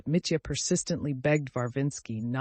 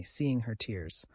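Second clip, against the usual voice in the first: ordinary delivery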